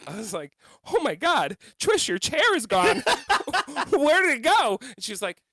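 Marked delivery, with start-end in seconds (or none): 0.9-4.8 loud, high, laughing alarm; alarmed exclamation. Retold or imitated. Telling Trish her chair vanished, loud and alarmed.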